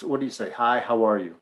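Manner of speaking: ordinary talk, nothing marked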